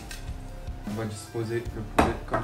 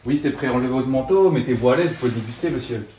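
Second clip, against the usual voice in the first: loud; 7 dB louder than usual. Acted. Calling out order loudly.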